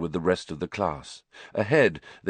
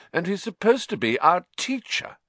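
higher, mocking tone; sneering; 11 semitones higher than usual. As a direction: scorn for the teacher. Malfoy sneering.

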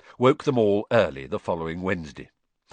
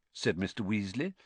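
ordinary delivery, nothing marked

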